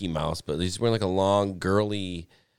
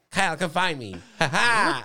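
put-on taunting kid; 7 semitones higher, 4 dB louder than usual. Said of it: "was like".

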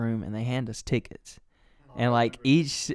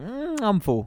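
mock dainty date; 10 semitones higher, slower than usual. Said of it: "was like".

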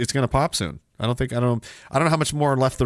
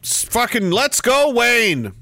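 higher, drawn-out hype cheer; hyped-up cheer; 7 semitones higher, much slower than usual. Acted. Host cheering Wayne on after the hype song, hyped up. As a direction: cheer along, stretching the words.

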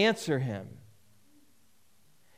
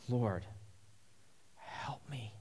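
soft plea sinking into a whisper; hushed plea; 5 semitones lower, 14 dB quieter, slower than usual. Acted. Woman kneels before Jesus begging help, hushed to a whisper. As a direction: beg quietly, fading to a bare whisper.

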